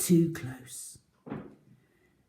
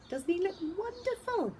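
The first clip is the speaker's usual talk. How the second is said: gushing; 14 semitones higher, 5 dB quieter than usual. Acted. Teacher admiring the puppet.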